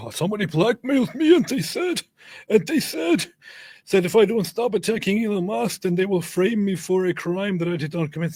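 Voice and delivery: very high, whining mock protest; mock panicked victim. Mocking someone seeking a way out, high hammed-up whine. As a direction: squeal it like a badly faked victim. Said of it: "saying something like this".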